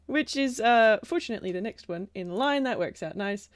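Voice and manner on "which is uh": normal talking voice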